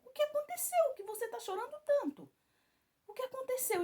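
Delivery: high, anxious pleading question; alarmed plea. Retold or imitated. Mother to the crying driver, asking high and alarmed. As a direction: ask urgently, rattled by her sobbing. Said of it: "eu falei".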